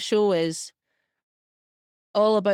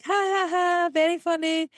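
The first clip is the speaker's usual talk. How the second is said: high mocking ha ha ha, very funny; fake-laugh it off, pitched well up; sarcastic laugh; 10 semitones higher than usual. Friends on Zoom mocking the filter, high sarcastic laugh. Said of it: "And they're all like".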